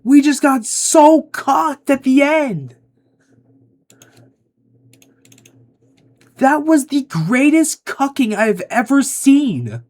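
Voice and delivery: high-pitched, full-volume shouting; outraged yelling. Real, not acted. Player raging at getting beaten at the end, screaming. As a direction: yell in disbelief, voice pushed way up high.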